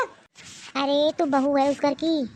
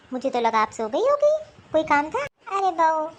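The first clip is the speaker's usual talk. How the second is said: ordinary talk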